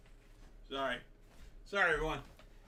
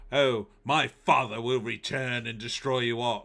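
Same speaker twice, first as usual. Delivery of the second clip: louder, mock British stage accent; hammed-up British line, louder; 7 dB louder than usual. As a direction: deliver it like a proper Brit actor. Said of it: "like a, a Brit".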